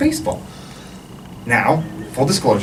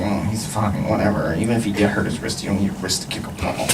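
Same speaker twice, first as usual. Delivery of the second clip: low, soft, dismissive grumble; grumbling; 6 semitones lower, 5 dB quieter than usual. Acted. Mom at the hospital during x-rays, low dismissive grumbling. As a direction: grumble it under your breath, quickly. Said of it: "my mom's still grumbling".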